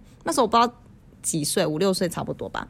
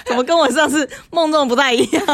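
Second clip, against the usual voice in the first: laughing; 8 semitones higher, 5 dB louder than usual. Real, not acted. Laughing while retelling mom's line.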